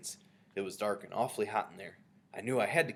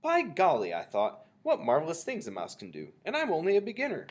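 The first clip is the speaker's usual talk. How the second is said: higher, louder burst of wonder; gleeful amazement; 7 semitones higher, 5 dB louder than usual. A narrator's reading. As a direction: marvel with real gusto. Mouse marvels at itself in thought.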